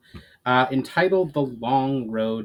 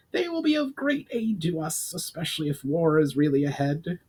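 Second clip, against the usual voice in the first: high goblin voice; a goblin chief giving thanks; Brawl, grateful goblin; 6 semitones higher than usual. A narrator's reading. Brawl continues.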